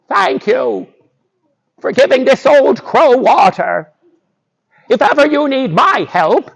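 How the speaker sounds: high-pitched talking crow voice; grateful old crow. Acted. Revived crow offers Boots help in return, grateful.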